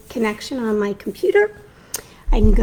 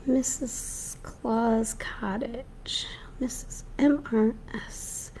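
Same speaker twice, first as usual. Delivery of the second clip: whispering; 10 dB quieter, much slower than usual. Real, not acted. Whispering while searching page.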